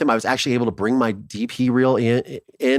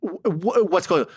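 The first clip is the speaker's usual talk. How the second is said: excited stammer; 7 semitones higher than usual. Retold or imitated. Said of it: "I'm like".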